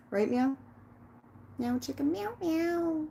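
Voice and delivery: high cooing pet voice. Real, not acted. Cooing to the cat named Meow.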